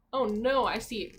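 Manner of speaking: plain reading voice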